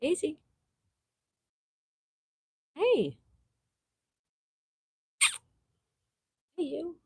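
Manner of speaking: high, soft coaxing pet call; coaxing the dog. Real, not acted. Woman calls her sleepy dog Daisy, high soft coaxing.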